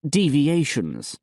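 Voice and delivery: deviations drawn out slowly and pointedly; pointed stress. Acted. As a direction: lean on deviations with slow emphasis. Bishop stressing a pointed word.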